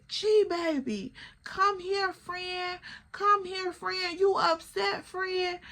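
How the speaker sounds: high, cooing mock drunk voice; drunk friend calling. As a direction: imitate a tipsy woman coaxing her friend over. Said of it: "calling Gam".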